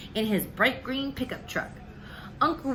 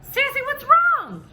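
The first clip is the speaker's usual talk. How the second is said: high, loud, slow alarmed question; worried uncle; 9 dB louder than usual. Acted. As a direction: call out in sudden concern. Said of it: "he said".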